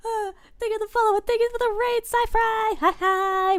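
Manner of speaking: high, loud squeaky thanks; overjoyed high-pitched shriek of welcome; excited squeal. Real, not acted. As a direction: squeal your thanks to the raiders. Thanking for a raid.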